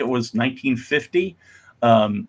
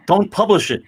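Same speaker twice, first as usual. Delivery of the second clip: raised, higher warning voice; warning old timers; 7 semitones higher, 3 dB louder than usual. Acted. Old timers warn the editors, raised and higher. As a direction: warn them off like a worried veteran. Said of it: "we were warned by old timers".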